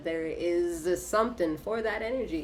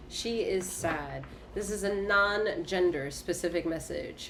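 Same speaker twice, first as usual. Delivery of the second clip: normal talking voice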